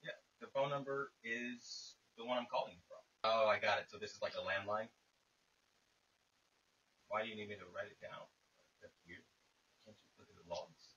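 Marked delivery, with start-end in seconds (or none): none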